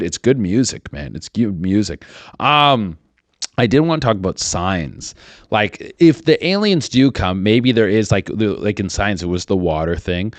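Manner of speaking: ordinary talk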